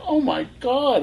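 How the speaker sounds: sudden high squeak of amazement; learning she grew up nearby, a high amazed gasp. Retold or imitated. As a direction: leap way up in pitch, stunned. Amazed both from Marin.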